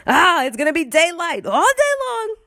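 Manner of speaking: high-pitched mock-alarmed impersonation; Donald Trump. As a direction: put on a high, hammed-up politician voice. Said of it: "I'm doing my Trump voice".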